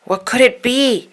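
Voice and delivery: ordinary delivery, nothing marked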